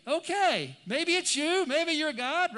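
high, loud, sceptical comeback; incredulous retort. Acted. Doubting disciple answers Jesus, loud and incredulous.

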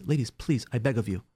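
normal talking voice

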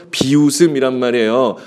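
ordinary delivery

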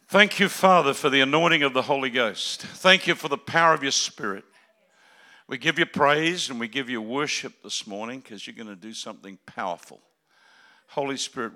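loud fervent prayer. Real, not acted. Preacher praying over the congregation, loud prayer. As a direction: lift it up to God.

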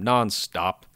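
ordinary talk

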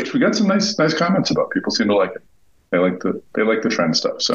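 sounds like a bag over the head; muffled, poor headset sound; muffled audio. Real, not acted. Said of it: "I'm gonna be a little muffled".

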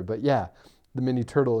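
normal talking voice

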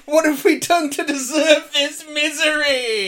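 high, loud hammed-up wail; mock despair. Co-host wailing in mock misery at the intro. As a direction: wail in comic anguish at the show.

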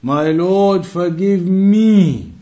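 ordinary delivery

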